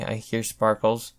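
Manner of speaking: ordinary delivery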